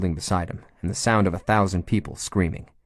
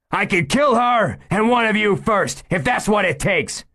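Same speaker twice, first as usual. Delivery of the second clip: high, loud, strained hostage-taker; a cornered man's menace, pitched high; desperate gunman; 14 semitones higher, 4 dB louder than usual. Acted. Cornered gunman threatening the hostage, tense high voice.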